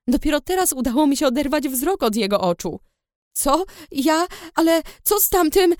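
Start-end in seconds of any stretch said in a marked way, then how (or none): 3.3-5.7 rising, halting confused questions; stammering. A narrator's reading. Girl stammering at the dragon rider, rising pitch. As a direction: stammer it out, shaken. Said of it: "Dukałam".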